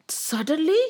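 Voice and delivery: ordinary delivery, nothing marked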